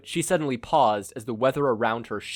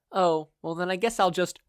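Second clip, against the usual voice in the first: much higher, sweetly polite; polite captive mother; 8 semitones higher, 4 dB louder than usual. Acted. Blindfolded mother politely interrupts her kidnapper. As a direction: interrupt with mock courtesy. Inko feigning to give up.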